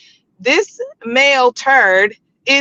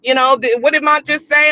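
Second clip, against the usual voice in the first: usual speaking voice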